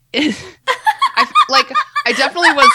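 squealing high with laughter; laughing. Real, not acted. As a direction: laugh through the words, climbing high. Laughing at giggling girls.

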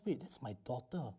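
hushed, dawning recognition; sudden realization. Acted. Father suddenly recognizing his daughter at the door, hushed realization. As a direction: say it softly as it sinks in. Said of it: "He suddenly realized".

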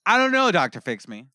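a sudden yell of frustration; exasperated shout. Real, not acted. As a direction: blow up at the therapist. Repeats line, second time shouted.

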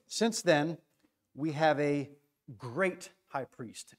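"great" stretched and stressed, the rest slowed. Shown as a demonstration. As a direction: lean hard on "great" and draw it out. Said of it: "Emphasis on the word great".

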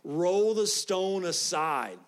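loud, slow command to the crowd. Acted. Jesus orders the stone rolled aside, loud slow command. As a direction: call it out slowly and loud, with authority.

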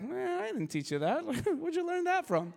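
high-pitched, acted-out dad in disbelief; puzzled dad. Dad questioning his son as they walk away, high mock disbelief. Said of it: "the dad's like".

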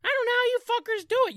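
fast high exasperated rant. Real, not acted. Exasperated outburst.

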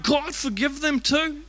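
high, drawn-out plea to God; Jesus crying out. Acted. Jesus on the cross begs forgiveness for others, acted high cry. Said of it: "And he's crying out".

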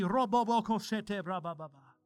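mock tongues burst; speaking in tongues. Shown as a demonstration. Pastor showing congregation, speaking in tongues. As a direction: rattle off tongues. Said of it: "but for me".

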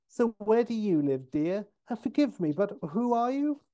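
put-on high-pitched polite old dear; sweet friendly grandma. Short lady questioning a visitor, sweet high grandma voice. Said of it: "she's very sweet and polite".

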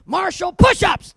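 slow, loud, high barked command; drill sergeant order. Retold or imitated. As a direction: bark the order down at the recruit.

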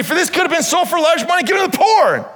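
high, fast, whiny objection; complaining disciples. Acted. Disciples protesting the woman's costly gift, high and fast. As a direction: rattle it off like a self-righteous critic.

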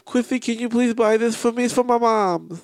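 high, whiny lisped plea; lisping impression. Friend mimicking her asking for gifts for mom, lisping whine. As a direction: beg in a mock little-kid lisp. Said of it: "that's another impression".

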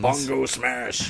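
slow dumb brute growl; Hulk smash voice. Pretend. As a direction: say it like a lumbering Hulk. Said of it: "I just wanna hear bon".

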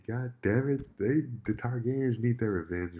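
loud, high, fired-up rant; pissed off. Real, not acted. Angry fan ranting to a friend about the finale, loud. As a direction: vent the anger, full voice. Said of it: "I'm pissed".

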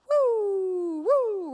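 high, loud "woo" siren wail; long falling siren whoops; imitated police siren. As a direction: mimic a police siren with your voice. Imitates police siren behind her.

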